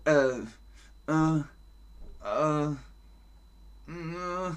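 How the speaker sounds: hesitant high "äh" noises, fading; stuck, wordless dithering with gaps between; indecisive stammer. Shown as a demonstration. Teacher acting out decision paralysis, dithering noises. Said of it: "Entscheidungsparalyse ist so".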